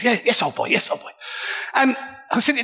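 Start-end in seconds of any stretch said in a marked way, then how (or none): 0.0-1.1 lightly voiced posh old man; a hint of the old boy's reply; old English gent. Old gambler answering the narrator, lightly voiced gent. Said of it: "He said".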